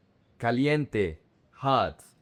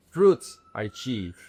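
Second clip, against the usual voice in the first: ordinary delivery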